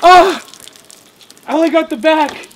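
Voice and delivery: high, loud startled shout after cold water; shocked yelp. Real, not acted. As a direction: yell out in shock at the icy splash. Ice bucket dumped on him.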